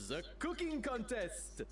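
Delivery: high, theatrical reveal; grand announcement. Acted. Go-between unveiling the old tradition to rival chefs, grandly. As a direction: proclaim it with a flourish, pitch soaring.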